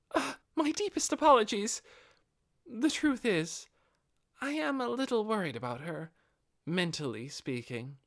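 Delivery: a retainer caught off guard by her master; flustered apology. Acted. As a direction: blurt the apology, then confess the worry. Albedo apologizes, then worries.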